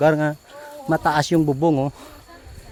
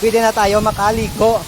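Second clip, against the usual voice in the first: excited; 4 semitones higher than usual. Real, not acted. Eager about swimming after hike.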